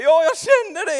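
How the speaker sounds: high, loud, overcome cry; a colleague overwhelmed by emotion, crying out. Retold or imitated. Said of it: "Och hon säger".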